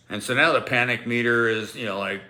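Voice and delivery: usual speaking voice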